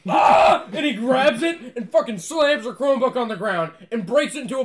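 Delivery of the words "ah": sudden loud mock scream; short, explosive imitated shriek; panicked scream. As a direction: yell out as if horrified. Acting out boy's phobic panic.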